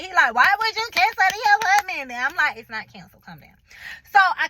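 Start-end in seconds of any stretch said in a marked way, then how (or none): 0.3-2.1 higher and louder, exasperated question; a boyfriend snapping, fed up; frustrated boyfriend. Retold or imitated. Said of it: "he getting frustrated with me". 2.6-3.6 much softer, lower soothing reply; telling an upset boyfriend to calm down, much softer and lower. Retold or imitated. Calming frustrated boyfriend.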